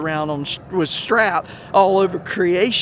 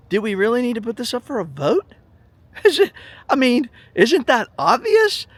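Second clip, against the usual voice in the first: incredulous laughing; 6 semitones higher, 5 dB louder than usual. Retold or imitated. Asking the band president.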